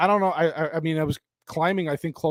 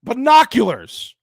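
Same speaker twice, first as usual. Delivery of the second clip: high, loud and drawn out; exasperated disbelief; 5 semitones higher, 8 dB louder, slower than usual. Real, not acted. Reviewer repeating the ridiculous plot device, exasperated. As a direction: stretch the word out in appalled disbelief.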